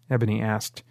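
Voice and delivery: plain reading voice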